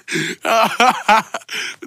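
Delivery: long run of laughter; helpless laughing at own joke; hearty laughing fit. Real, not acted. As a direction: crack up and keep laughing. Cracking up mid-sentence.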